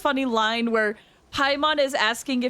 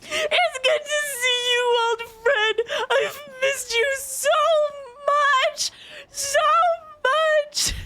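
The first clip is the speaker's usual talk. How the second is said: high, slow, mock-weepy reading; hammed-up tearful reunion; 11 semitones higher, slower than usual. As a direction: play the long-lost friend overdoing the tears. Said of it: "I have the thing".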